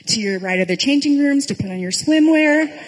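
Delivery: chirpy guide wrapping up the safety talk; cheery tour guide. Acted. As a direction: send them off brightly, pitch climbing to the end. Tour guide's cheery send-off after warnings.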